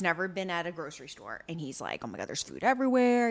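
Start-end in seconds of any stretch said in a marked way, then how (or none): 2.0-3.3 quick, understated wonder; mock excitement. Genie at his first grocery store, mildly excited. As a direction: imitate an amazed newcomer in a rush. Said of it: "He's so excited".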